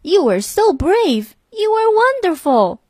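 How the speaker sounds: very high, excited little toad; high-pitched cry of praise; admiring cry. A narrator's reading. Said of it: "he cried".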